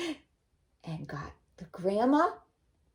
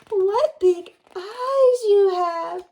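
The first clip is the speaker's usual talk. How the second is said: put-on amazed little girl; 13 semitones higher, 11 dB louder, much slower than usual. Red questioning disguised wolf.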